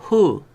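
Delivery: a hu with a breathy h; wrong h reading. Shown as a demonstration. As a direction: read "hu" like the h sound. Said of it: "ya no se lee".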